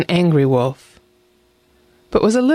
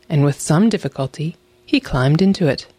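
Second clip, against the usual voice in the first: plain reading voice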